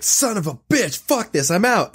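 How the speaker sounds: high, mock-frustrated outburst; imitating a fed-up porn actor storming off. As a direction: quit in a huff. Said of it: "And then he's like".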